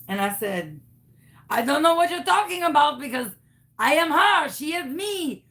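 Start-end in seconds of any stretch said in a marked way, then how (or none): 1.5-5.5 high, insistent French-Canadian caricature; fake Canadian accent. Caller swearing she is her friend, fake Canadian accent. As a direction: protest in a hammed-up French accent, then fake laugh. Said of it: "What happened to your accent?".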